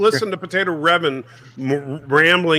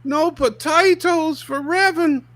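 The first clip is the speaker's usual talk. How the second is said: slow, much higher put-on jeer; high mock taunt; 12 semitones higher, slower than usual. Co-host rubs in the potato famine to Revan, high mock taunt. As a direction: squeal it slowly to tease. Teasing the Irish friend.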